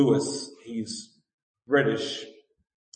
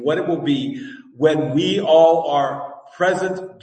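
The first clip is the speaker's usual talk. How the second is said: louder, swelling, half-sung preaching build; preaching at full force; 5 semitones higher, 9 dB louder than usual. Acted. Pastor rousing the congregation about glorified bodies, full preaching force. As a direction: lift the whole room with the vision of glory.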